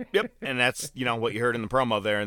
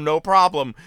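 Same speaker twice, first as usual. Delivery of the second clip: normal talking voice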